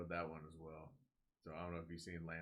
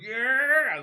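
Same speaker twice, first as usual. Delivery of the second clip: long, high, loud mock party yell; frat-boy whoop; 20 dB louder than usual. Zombies driving the Jeep around like frat boys, imitated loud whoop. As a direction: holler like frat boys out joyriding. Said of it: "like a bunch of frat boys in a car".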